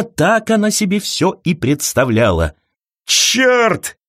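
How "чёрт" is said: mild outcry. A narrator's reading. Agent cursing over spilled coffee, restrained high-pitched outcry. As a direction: cry out a curse with restraint. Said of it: "выкрикнул кто-то".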